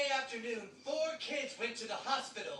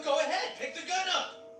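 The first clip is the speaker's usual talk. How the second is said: yelling; 5 semitones higher, 4 dB louder than usual. Acted. Drill instructor yelling at class.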